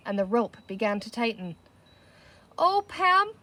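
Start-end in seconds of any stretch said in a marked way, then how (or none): 2.6-3.3 put-on high, frightened girl's cry. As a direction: cry out in alarm. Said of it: "cried Penny".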